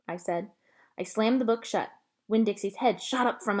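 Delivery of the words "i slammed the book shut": plain reading voice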